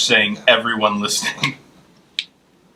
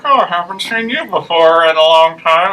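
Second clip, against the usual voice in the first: Danielle; 6 semitones higher, 5 dB louder than usual. Retold or imitated. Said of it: "She was like".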